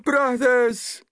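high, loud call to the crowd; appealing villager. A narrator's reading. Villager calling on the crowd for help, high and loud. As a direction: appeal to them, arms open. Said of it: "he appealed to the crowd".

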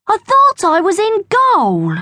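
put-on high, puzzled boy's protest; Bertie, indignant schoolboy. A narrator's reading.

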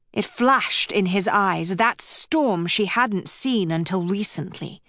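plain reading voice

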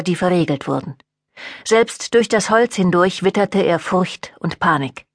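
usual speaking voice

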